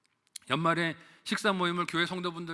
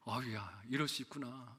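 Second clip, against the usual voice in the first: lower, softer, rueful sigh; 5 semitones lower, 10 dB quieter than usual. Retold or imitated. Retold sigh of realization.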